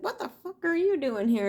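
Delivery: high, fast startled demand; startled alien voice. Retold or imitated. Alien being startled by an intruder, high fast demand. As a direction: fire it off high like a shocked stranger. Surprised entity confronting her.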